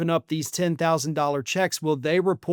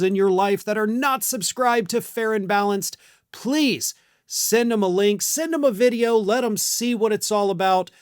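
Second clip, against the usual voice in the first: upbeat channel plug; 3 semitones higher than usual. Acted. Host rallying viewers to subscribe, upbeat promo read. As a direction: pitch the subscribe ask with energy.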